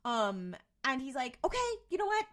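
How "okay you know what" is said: high, loud fed-up burst; retold exasperation. Trickster gives up on tormenting Sam, exasperated. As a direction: imitate someone throwing in the towel.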